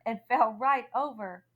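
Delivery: ordinary delivery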